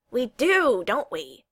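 pouncing, with a loud start; almost accusing. A narrator's reading. Neil points a finger at Kel, almost accusing. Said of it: "almost accusingly".

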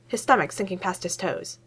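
usual speaking voice

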